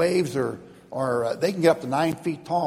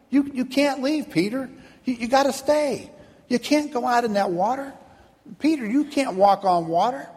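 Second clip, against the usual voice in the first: higher, hurried begging of fellow disciples; pleading naysayers; 8 semitones higher than usual. Acted. Fellow passengers begging Peter to stay, mildly pleading. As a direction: grab his arm and beg him to stay.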